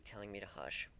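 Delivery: usual speaking voice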